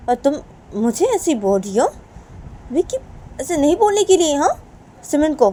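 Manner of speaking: indignant father taking the daughter to task; scolding father. Acted. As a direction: scold her, pitch climbing toward the end. Plays father scolding Sunaina. (बेटी को डाँटते पिता की आवाज़)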